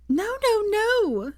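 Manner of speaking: put-on high, sudden drunken shriek. A narrator's reading. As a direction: shriek to cut off the song. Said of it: "shrieked Hermione suddenly".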